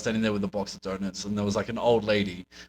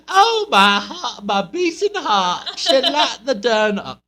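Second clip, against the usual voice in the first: very high, slow, swooning Southern lady; Southern American accent; 10 dB louder, much slower than usual. Retold or imitated. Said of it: "Southern American accent?".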